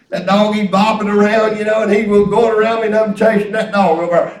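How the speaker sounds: ordinary delivery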